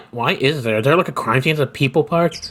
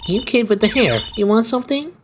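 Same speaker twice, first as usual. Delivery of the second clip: put-on much higher old lady voice, lifting "want"; an old gangster lady eyeing the kid; 6 semitones higher than usual. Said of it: "some kinda old lady voice".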